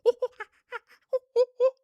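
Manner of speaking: high, loud monkey hoots with a laugh; monkey noises. Retold or imitated. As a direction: hoot like an excited chimp. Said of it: "Like jumping up and down in a cage".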